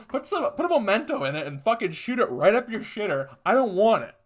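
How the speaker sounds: high, loud, furious comic rant; yelling rant. Acted. Ranting at Belinda over the unwanted soda pop, full yell. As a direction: blast it at her like she robbed you.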